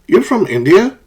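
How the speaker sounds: "You're from India?" climbing high on "India"; unsure questioning rise. Shown as a demonstration. Someone not sure where this person is from, questioning rise. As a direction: check a guess, voice lifting sharply on "India". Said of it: "They're not sure if this person".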